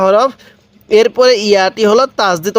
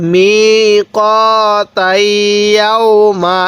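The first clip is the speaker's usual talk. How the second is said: very slow, every syllable stretched; drawn-out reading; much slower than usual. Shown as a demonstration. Teacher drawing out the word for students, very slowly. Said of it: "এরকমভাবে পড়ব".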